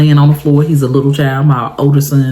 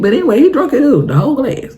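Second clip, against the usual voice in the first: excited amusement; 9 semitones higher than usual. Real, not acted. Amused about officer drinking it.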